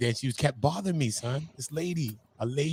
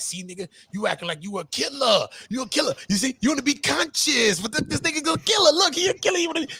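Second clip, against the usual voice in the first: mocking critics; 11 semitones higher than usual. Podcaster mocking his online critics in a high, shouty voice. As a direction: ham up the accusers pointing at a killer. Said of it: "that's what they wanna make me out to be".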